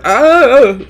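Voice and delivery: sudden high, loud yelp; shocked shout as it snaps; startled cry. Acted. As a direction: yelp as the branch gives way. Branch breaks.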